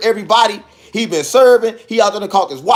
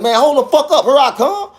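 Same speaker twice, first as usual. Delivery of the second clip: raised-pitch "hang on" call; brusque casual holler; 6 semitones higher than usual. Retold or imitated. Man answering the knock like it's a regular, casual brusque holler. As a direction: call out as if to a familiar customer. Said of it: "I'm talking like a regular".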